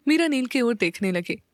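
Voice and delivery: ordinary delivery, nothing marked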